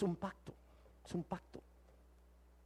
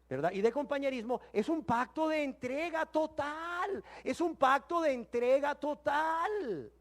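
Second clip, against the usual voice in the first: higher, louder, building sermon voice; preaching at full force; 8 semitones higher, 4 dB louder than usual. Acted. Preacher to the congregation, preaching with rising force. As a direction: climb to the peak of the message.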